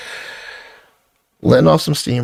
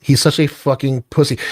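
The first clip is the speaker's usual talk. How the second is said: ordinary delivery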